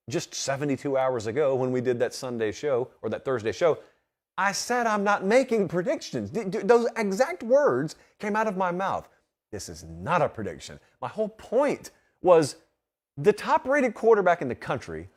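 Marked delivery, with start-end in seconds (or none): none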